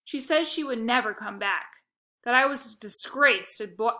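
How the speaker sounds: usual speaking voice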